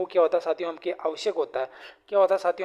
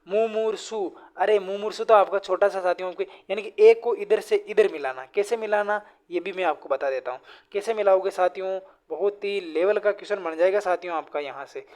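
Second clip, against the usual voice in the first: ordinary delivery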